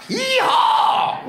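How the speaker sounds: high, loud whooping yeehaw; farmer yeehaw. Retold or imitated. Farmers answer Jackson's call to arms, whooping yeehaw. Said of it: "which is farmer for yes".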